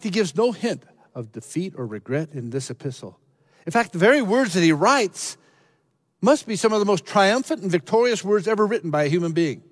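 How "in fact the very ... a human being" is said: high, loud, soaring declaration; triumphant preaching. Real, not acted. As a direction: lift it up in triumph.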